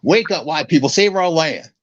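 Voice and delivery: a hint of the fired-up separatist from the comedy sketch; Clayton Bigsby impression. Redoing a Chappelle sketch for his cohost, lightly voiced Bigsby rant. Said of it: "this is a bit from Dave Chappelle".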